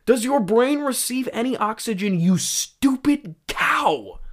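mock aggressive. Reviewer rereading Bell's insult, mock aggressive. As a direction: stretch it out with hammed-up scorn. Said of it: "really aggressive line".

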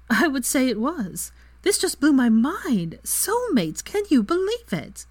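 high-pitched, giddy disbelief; amused amazement. A narrator's reading. Friend marvelling at soulmates, amused amazement. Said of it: "broke in with another laugh".

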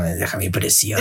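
a hint of a Gollum voice; understated Gollum impression. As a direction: lightly mimic a creature guarding its treasure. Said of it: "estabas como Gollum".

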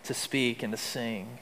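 softly reassuring, a touch of Jesus speaking gently; soft reassurance. Retold or imitated. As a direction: murmur it kindly, like comforting a frightened man. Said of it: "as if to say".

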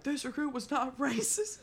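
high-pitched mock protest; high voice cracking into laughter; piping-up recruit. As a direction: imitate a recruit piping up in denial. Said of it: "he pipes up".

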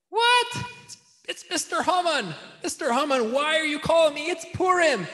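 high-pitched, hammed-up dismay; mock shock. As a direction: play shocked at an unwelcome caller. Pretend shock at Haman's phone call.

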